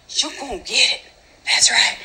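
hushed, half-whispered warning; muttered mock threat. Warning Nicole she's in trouble, hushed mock threat.

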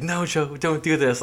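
high, pleading soap-opera line; heightened drama. Retold or imitated. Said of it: "like, heightening it".

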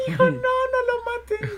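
pretending to be a frantic father to troll scammers; hammed-up panicked parent. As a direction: beg like a parent in a fake panic. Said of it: "decirle así como de".

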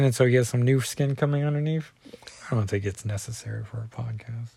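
ordinary talk

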